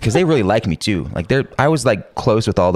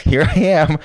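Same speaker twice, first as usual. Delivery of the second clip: high, breezy innocent greeting; cheerful innocent; 11 semitones higher, slower than usual. Retold or imitated. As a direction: act like nothing happened. Showing up after blackout.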